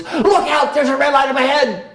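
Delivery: high, loud back-seat driver warning; nagging passenger. Retold or imitated. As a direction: play the passenger shouting a warning. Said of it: "The person in the front seat goes".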